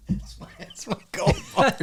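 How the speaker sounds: high, breathless laugh through the words; laughing mock claim. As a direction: squeak it out while cracking up. Repeats the joke, laughing.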